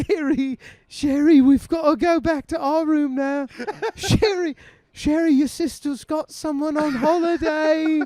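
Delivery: high, loud mum voice; mom's voice. Retold or imitated. Mum herding drunk daughter off, high and loud. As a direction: herd her away like a mum.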